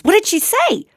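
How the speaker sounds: high, eager question; eager friend. Retold or imitated. Pet store owner curious about the wife's reply, high and eager. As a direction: ask it eagerly, leaning in.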